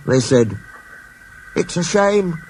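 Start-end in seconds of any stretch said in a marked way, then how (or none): 1.6-2.4 sympathetic. Acted. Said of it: "sympathised Percy".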